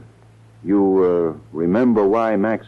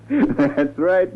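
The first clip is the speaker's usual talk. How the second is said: laughing; 6 semitones higher than usual. Acted. Conrad laughing at Max.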